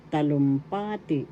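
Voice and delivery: teacher's model of talumpati, accent on PA; stress on third syllable. Shown as a demonstration. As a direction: lean on the pa in talumpati. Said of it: "third syllable has the accent".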